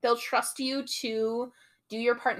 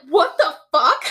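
loud, high mock-appalled cry; retold shout of disbelief; shocked outburst; 6 semitones higher, 9 dB louder than usual. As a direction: burst out in disbelief, imitating his shock. Acted reaction to her sawing her hand.

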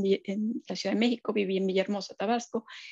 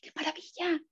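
high, soft breath of wonder; awed delight; 8 semitones higher, 7 dB quieter than usual. Retold or imitated. Looking at the snow, marveling, high and hushed. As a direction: gasp it out in awe. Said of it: "ahorita estoy viendo la nieve y digo".

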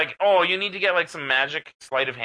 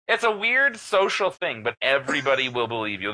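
usual speaking voice

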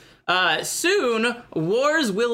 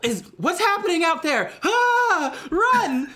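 mock panic; 9 semitones higher than usual. Germans in the meeting hall fleeing, high mock panic. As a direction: squeal in fake terror.

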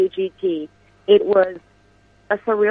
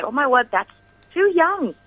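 high-pitched exaggerated disbelief; mock shock; 7 semitones higher than usual. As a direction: play up their shock at her age. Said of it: "everyone's gonna say".

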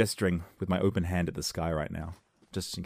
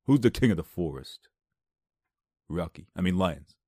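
lightly voiced tough-guy lion; mock movie tough guy playing a lion; Sylvester Stallone impression; 2 semitones lower, 3 dB louder than usual. Stallone being the lion, mock tough-guy voice. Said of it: "That'd be Sylvester Stallone being the lion".

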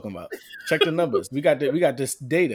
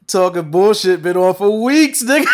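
loud scoffing; 4 semitones higher, 10 dB louder than usual. Real, not acted. Defending podcast, loud and amused.

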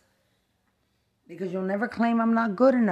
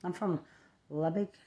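ordinary delivery

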